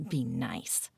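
low, soft, sulky mutter; mocking the advice in a sulky mumble; mumbled. A narrator's reading. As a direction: grumble it under your breath. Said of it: "Kat mumbled".

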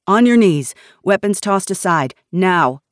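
loud order at gunpoint, starting high; barked command. A narrator's reading. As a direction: demand instant obedience. Nash orders refugees down at gunpoint.

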